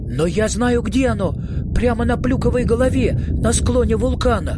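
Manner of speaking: eager outburst; urgent exclaiming. Acted. Urgent calling after dropped milk.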